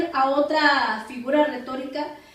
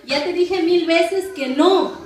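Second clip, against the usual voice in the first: higher, louder, quick mock scolding; exasperated scolding; 4 semitones higher, 6 dB louder, slower than usual. Mother to child asking to play once more, exasperated. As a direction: act out a mother's fed-up refusal.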